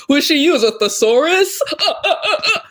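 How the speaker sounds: mocking friend. The friend mocks her big words, loud sneering taunt. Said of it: "It's like".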